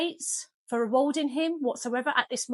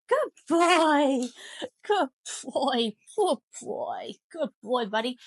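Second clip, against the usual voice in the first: high, happy praise to a dog; real high-pitched dog praise, easing off at the end; excited praise; 4 semitones higher, 3 dB louder, slower than usual. Real, not acted. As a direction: gush over the dog as a reward. Rewarding dog released from stay.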